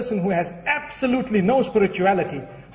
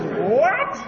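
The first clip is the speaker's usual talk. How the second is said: indignant woman; 5 semitones higher than usual. Acted. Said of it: "She says".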